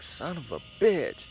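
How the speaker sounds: slow, strained mock curse of pain; imitating a pained groan. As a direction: curse through a cramp. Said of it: "I go to stand up and I'm like".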